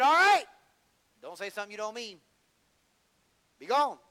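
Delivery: commanding shout. Acted. Jesus commands the demons.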